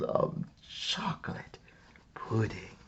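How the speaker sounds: whispered, awed; hushed awe. A narrator's reading. Narrator to listeners, awed by giant pudding bowls, whispered.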